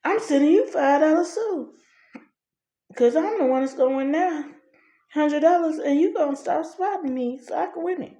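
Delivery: high, sassy comeback; imitating her getting smart; smart-mouthed. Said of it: "She got smart and said".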